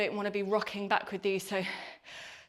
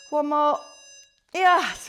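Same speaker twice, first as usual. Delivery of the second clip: shouting encouragement; loud cheer; 3 semitones higher, 8 dB louder, much slower than usual. Real, not acted. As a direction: call it out loud to push them. Coach cheering through the burn.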